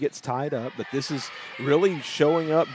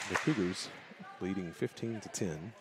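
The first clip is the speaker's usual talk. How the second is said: hushed golf commentator, much softer; golf announcer voice; 5 semitones lower, 11 dB quieter than usual. Pretend. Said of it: "I need to use my golf announcer's voice".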